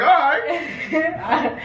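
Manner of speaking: high laughing take on him; mock casual reply. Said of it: "you were just like".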